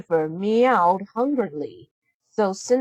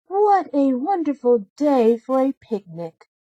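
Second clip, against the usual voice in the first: bright, higher happy voice; 6 semitones higher than usual. A narrator's reading. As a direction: sound delighted about the picnic. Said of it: "she said happily".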